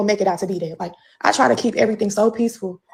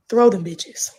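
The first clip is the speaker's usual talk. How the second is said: whispered dare; 2 semitones lower, much slower than usual. Real, not acted. Challenging critics.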